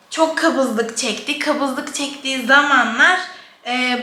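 normal talking voice

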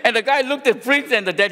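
high loud laugh. Real, not acted. Pastor laughing hard at his own story. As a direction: burst out laughing.

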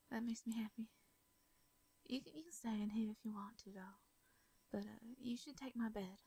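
plain reading voice

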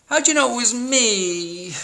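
high, drawn-out storybook turkey moan; hammed-up moan. A narrator's reading. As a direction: moan it like a found-out cartoon turkey. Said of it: "moaned Turkey".

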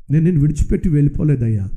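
low, steady divine reassurance; God gently correcting the believer. Acted. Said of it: "అప్పుడు దేవుడు అంటున్నాడు".

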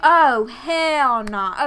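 loud, slow, drawn-out hell nah; disbelief. Real, not acted. Loudly refusing as the rival shows up at the club. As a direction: drag it out like you can't believe it.

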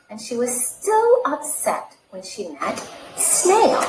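lively read-aloud storytelling voice; animated picture-book reading; story narration. Acted. Reads picture book to children.

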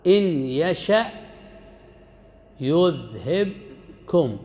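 slowly drawn-out, chanted verse; Quran recitation. Shown as a demonstration. Teacher reciting a verse to his students, chanted and drawn out. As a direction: recite it with the last word stretched long. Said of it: "يقول الله سبحانه وتعالى".

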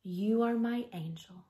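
ordinary delivery, nothing marked

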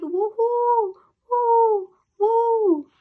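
high, loud, slow ghostly howl; howling woo. A narrator's reading. Girl imitating the dog's night howl, high and loud. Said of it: "It sounded like".

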